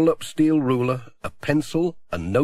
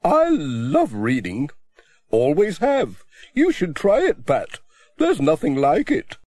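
put-on a bit higher, chatty older lady; a book-loving old lady, gently lifted; lightly raised voice for an eager reader; lightly voiced Miss Hubbard; 4 semitones higher, 3 dB louder than usual.

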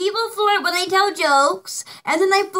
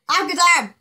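usual speaking voice

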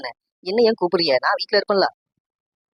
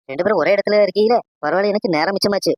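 usual speaking voice

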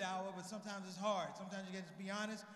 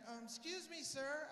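nosy lady passenger; 6 semitones higher, slower than usual. Retold or imitated. Lady interrupting a praying stranger on a flight, nosy-lady voice. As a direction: imitate a polite, prying woman.

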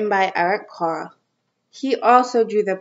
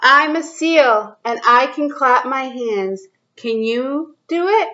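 put-on reading to children; 3 semitones higher than usual. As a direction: read it out to little kids, drawing each line out. Reads picture book to kids.

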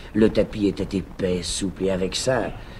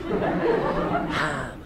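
breathy high laughing gasp; 3 dB quieter than usual. Acted. Mocking sigh.

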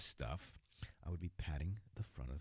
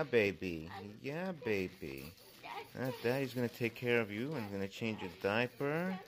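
put-on higher, sing-song baby talk to his partner; cooing daddy voice; 8 semitones higher, 3 dB louder than usual. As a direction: coo as if talking to an infant. Acts out the roleplay voice.

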